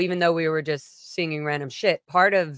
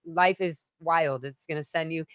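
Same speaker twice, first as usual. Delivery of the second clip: normal talking voice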